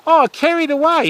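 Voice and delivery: high, delighted oh for the incoming raid; light up as the raid lands; excited greeting. Real, not acted. To the raiding streamer Carried Away, a high excited hello.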